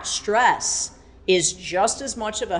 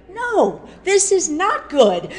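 much higher, louder no; loud protest; 12 semitones higher, 7 dB louder, slower than usual. Retold or imitated. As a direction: refuse the bright side outright. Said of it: "I'm always like".

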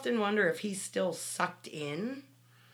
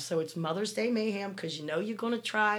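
usual speaking voice

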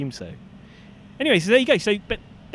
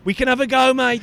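Aussie tradie, pitched up; chirpy Aussie bloke; aussie tradesman; 4 semitones higher, 3 dB louder than usual. Acted. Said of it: "it's Brian".

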